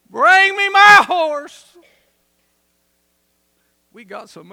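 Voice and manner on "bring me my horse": slow, loud, high proclamation; booming command. Acted. The general calls for his horse, booming shout. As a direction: shout it out like a king calling for battle.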